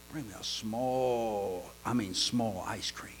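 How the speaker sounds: a slow near-whisper for something small; hushed request. Retold or imitated. Customer to waitress, whispering for small ice cream. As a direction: ask softly, almost whispering.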